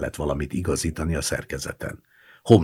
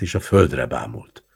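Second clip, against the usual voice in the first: ordinary delivery, nothing marked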